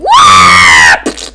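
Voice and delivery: loud, drawn-out scream of a word; flying war cry. Pretend. Player voicing a character throwing himself in the air, screaming. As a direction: yell it as you hurl yourself.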